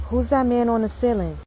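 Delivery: put-on little boy's question. Son asks parent about the ceiling, innocently. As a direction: deliver it simply, like a curious kid. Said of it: "this little boy said to me".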